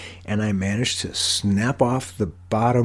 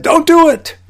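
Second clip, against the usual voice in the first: high, loud mock scream; imitated scream; 9 dB louder than usual. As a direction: shriek it as Jane's urgent warning. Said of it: "screamed at me and said".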